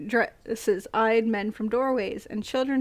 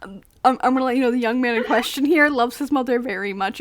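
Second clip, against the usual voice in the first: laughing; 3 semitones higher, 6 dB louder, faster than usual. Real, not acted. Reading through laughter.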